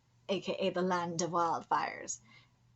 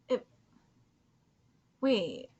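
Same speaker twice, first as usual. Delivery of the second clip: ordinary delivery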